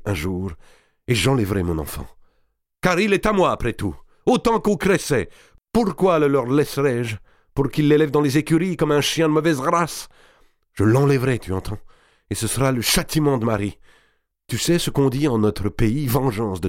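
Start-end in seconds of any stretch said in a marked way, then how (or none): 2.8-13.4 higher, louder heated vow; defiant, rising anger; angry vow. Acted. Guccio vows revenge. (serment rageur, plus haut et plus fort)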